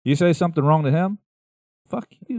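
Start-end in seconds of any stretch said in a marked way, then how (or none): 1.9-2.4 high mock snarl, barely voiced. Retelling how the guitarist would answer, high mock snarl. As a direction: spit it out like an angry rocker. Voicing angry rocker's retort.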